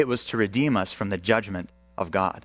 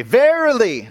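Verily shouted much higher and louder, falling at the end; bold proclaiming verily; 12 semitones higher, 8 dB louder, slower than usual. Shown as a demonstration. Preacher showing the church how to say Verily, bold shout. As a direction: belt out Verily like a herald. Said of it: "You will say".

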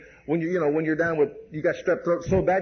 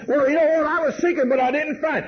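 higher, defiant whining excuse; stubborn retort; 7 semitones higher than usual. Retold or imitated. Bullheaded believer talking back to Jesus, high and stubborn. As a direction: mimic a hard-headed complainer. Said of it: "bullheaded and hard-headed and say".

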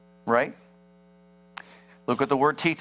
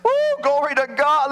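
loud, high cheering whoop; God cheering over his child, loud and high; 12 semitones higher, 6 dB louder than usual. Acted. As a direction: cheer like the whole stadium heard. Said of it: "God gets up and starts cheering".

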